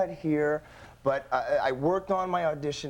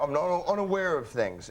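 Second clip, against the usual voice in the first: ordinary talk, nothing marked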